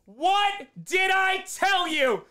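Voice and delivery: high, drawn-out triumphant yells; ecstatic shouting. Real, not acted. Fan streamer celebrating his team's interception, ecstatic shouting. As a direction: scream the big play, stretching the words.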